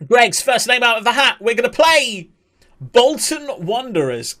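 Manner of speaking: high, loud announcer's reveal; excited draw announcement. Acted. Streamer revealing the cup draw to viewers, excited announcement. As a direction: call it out big, stretching the team name.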